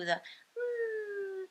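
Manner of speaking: put-on high, soft baby coo, well above her voice; a light, delighted little coo like a content baby; pleasure coo. Shown as a demonstration. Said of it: "the pleasure coo".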